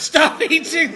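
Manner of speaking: high, loud mock Welsh shout; Welsh accent impression. Comedian scolding seaweed eaters, hammed-up Welsh accent. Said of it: "That is the best impression you've ever done".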